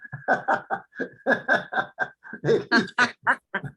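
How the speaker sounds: short burst of laughter; laughing at own joke. Real, not acted. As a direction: laugh off the pun about the K. (risa divertida por su propio chiste)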